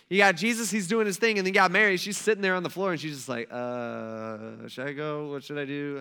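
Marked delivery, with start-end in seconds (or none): 3.5-6.0 low, hesitant imitation of confusion; unsure mumbling. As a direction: open on a long, dithering uh. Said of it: "she's trying to figure that out".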